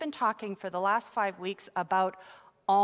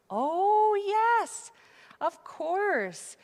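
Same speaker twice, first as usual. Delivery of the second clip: excited agreement; 10 semitones higher, 4 dB louder, much slower than usual. Acted. Replying to the puppet's whisper.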